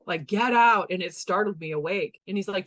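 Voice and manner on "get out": loud, raised mock yell; short burst of retold yelling; retold scream. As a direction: imitate a sleeper's sudden shout. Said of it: "he was screaming in his sleep".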